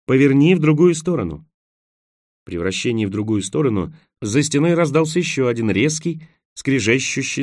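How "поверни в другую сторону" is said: building louder and higher in excitement; excited urging. A narrator's reading. Roen telling him which way to turn, excited. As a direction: urge it eagerly, stronger on the repeat. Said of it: "возбуждённо напомнил Роэн".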